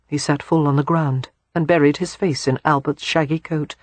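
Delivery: plain reading voice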